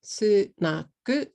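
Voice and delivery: "sunaku" slowly in three beats, su-na-ku; SNACK Japanese-style with added u sounds; katakana English. Shown as a demonstration. Teacher showing three syllables, katakana English. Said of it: "If you say it in katakana".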